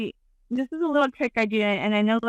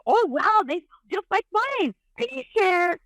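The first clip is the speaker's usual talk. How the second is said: much higher, mock amazed exclaim; mock amazement; 9 semitones higher than usual. Examining the friend's boobs, high mock amazement. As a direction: play up the surprise, pitched high. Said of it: "I go".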